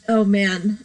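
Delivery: ordinary delivery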